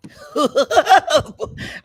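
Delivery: loud, high cackling laugh; high burst of laughter. Real, not acted. Laughing at own joke about Roman, loud high laugh. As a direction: break up laughing.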